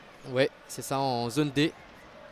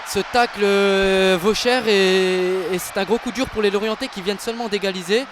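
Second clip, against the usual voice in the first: loud, high, urgent; excited sports commentary; 5 semitones higher, 6 dB louder than usual. Real, not acted. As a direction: stress the costly tackle. Reacting to red card decision. (commentaire sportif animé)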